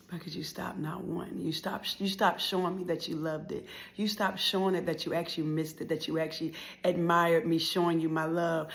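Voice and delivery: soft, subdued hurt; hushed, earnest reproach; heartfelt confession. Acted. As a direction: confide it quietly to mom. Tender confession to mother.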